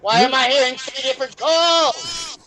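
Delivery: exasperated shout. Real, not acted. Confused by overlapping calls.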